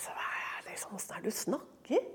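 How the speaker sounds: very soft, hushed objection; whispered protest. Acted. Speaker objecting to how he talks, whispered protest. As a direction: question it under your breath.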